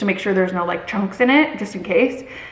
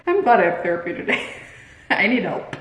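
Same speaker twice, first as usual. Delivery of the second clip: ordinary delivery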